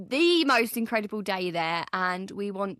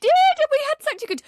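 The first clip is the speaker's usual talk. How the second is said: excited squeal; 9 dB louder than usual. Real, not acted. Excited gush about the day.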